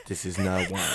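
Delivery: a mock snooty other face; snooty correction. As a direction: put her right with a sniff. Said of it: "And the other face came in".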